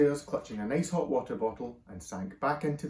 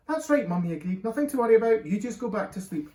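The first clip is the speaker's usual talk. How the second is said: put-on higher, louder eager boy; little boy voice; 9 semitones higher, 7 dB louder than usual. A narrator's reading. Small son telling mum all is fine, eager. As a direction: reassure mum brightly.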